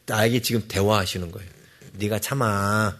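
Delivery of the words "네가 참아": low, slow and soft; a quiet nudge, barely there; gentle God voice. Pretend. Preacher voicing God softly urging patience mid-quarrel. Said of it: "하고".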